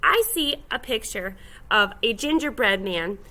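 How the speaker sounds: ordinary delivery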